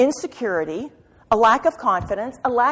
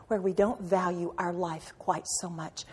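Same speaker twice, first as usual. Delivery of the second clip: ordinary talk, nothing marked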